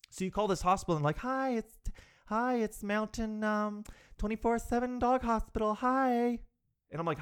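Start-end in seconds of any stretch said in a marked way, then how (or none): 1.2-6.4 chirpy receptionist. Retold or imitated. Receptionist answering phone.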